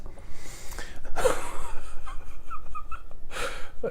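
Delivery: airy, unvoiced chuckle; breathy laugh. Real, not acted. Proud of being called the best captain, breathy laugh. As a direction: let a proud laugh slip out. Laughs at his own boast.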